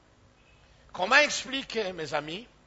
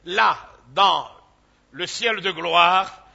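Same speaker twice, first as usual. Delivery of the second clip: louder and slower toward "le ciel de gloire"; preaching climax; 8 dB louder, slower than usual. Acted. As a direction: land the glory of heaven with weight. Climax of rhetorical question.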